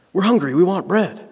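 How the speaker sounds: the crowd complaining to Jesus that they're hungry, whiny. Retold or imitated. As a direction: whine it like hungry people demanding bread. Voicing the demanding crowd.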